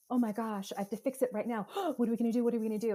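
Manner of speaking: a touch of panic, gasping; anxious fixing urge. Retold or imitated. Parent urgently wanting to fix a distressed child, mild panic. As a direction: rush to fix it, mildly frantic. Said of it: "it's just like".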